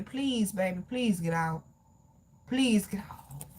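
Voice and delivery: subdued, serious urging; soft plea. Real, not acted. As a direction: beg quietly and earnestly. Pleading with viewer to leave.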